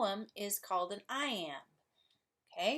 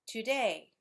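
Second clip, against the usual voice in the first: today with DAY louder and falling; stress on second syllable; 2 semitones higher, 5 dB louder than usual. Shown as a demonstration. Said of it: "stress falls on the second syllable".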